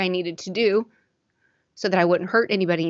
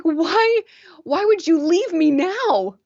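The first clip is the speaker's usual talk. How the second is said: high, tearful cry at the Pope; upset cry; 10 semitones higher, 4 dB louder, slower than usual. Retold or imitated. Guest crying out at the late Pope, tearful and upset. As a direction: wail it, hurt and angry. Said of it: "I was so mad".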